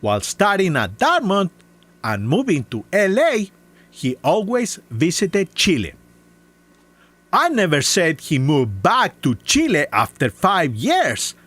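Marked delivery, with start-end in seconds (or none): none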